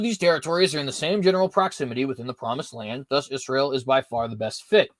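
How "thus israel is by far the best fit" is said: normal talking voice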